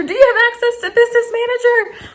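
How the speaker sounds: very high, loud, squealed asking; over-excited question. Retold or imitated. Customer bursting at support guy, over-excited. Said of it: "I'm so stoked".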